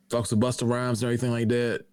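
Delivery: usual speaking voice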